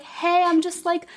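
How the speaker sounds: a hint of a chirpy agent faking calm; breezy Clarice. Clarice casually asking the killer.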